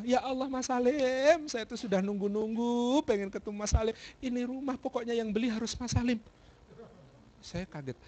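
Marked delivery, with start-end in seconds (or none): 0.0-6.2 imitated gushing owner, pitched up; eager house owner. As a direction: stretch the words as if long awaiting him. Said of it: "apa kata pemilik rumah?".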